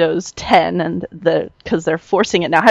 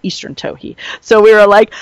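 usual speaking voice

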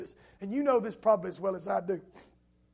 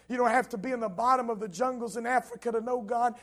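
normal talking voice

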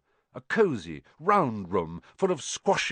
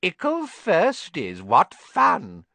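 mocking poltergeist; 6 semitones higher, 5 dB louder, slower than usual. A narrator's reading. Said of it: "with an evil cackle".

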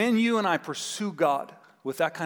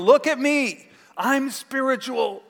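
high, loud bragging show-off; boastful show-off; 9 semitones higher, 6 dB louder, slower than usual. Pretend. Religious leader bragging about his phylactery, high and loud. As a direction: flaunt it for everyone.

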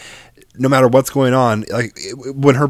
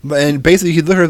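ordinary talk, nothing marked

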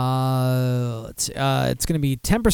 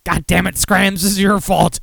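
mock blaming; 3 semitones higher, 5 dB louder than usual. Blaming a friend for the unfriending.

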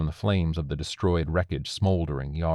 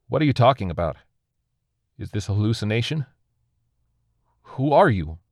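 raised, higher, bewildered; urgent questioning; 4 semitones higher, 4 dB louder than usual. A narrator's reading. Bewildered survivor questions the alien, urgent questioning.

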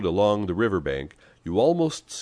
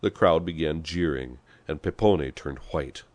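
plain reading voice

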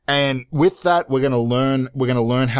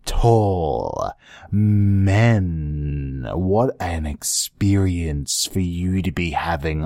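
low, slow sultry murmur; sexy voice; 5 semitones lower than usual. Acted. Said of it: "I'm doing, like, a sexy thing".

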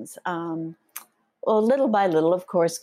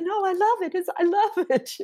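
much higher, eager insistence; eager reassurance; 14 semitones higher than usual. Retold or imitated. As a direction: gush that you love it. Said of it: "I said".

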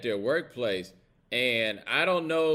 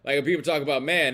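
ordinary talk, nothing marked

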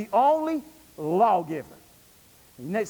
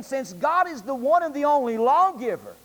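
ordinary talk

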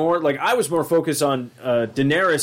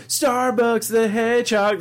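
mock announcer; 9 semitones higher, slower than usual. Mocking mashup title.

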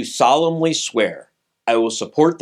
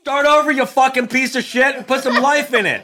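mock angry director; 11 semitones higher than usual. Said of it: "I'm teaching you how to direct".

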